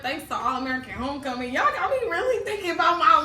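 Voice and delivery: loud, high-pitched excited outburst; worked-up exclamation. Real, not acted. Crediting a show for the self-reflection, loud and excited. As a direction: proclaim it loudly, pitch soaring.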